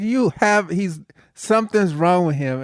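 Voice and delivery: ordinary delivery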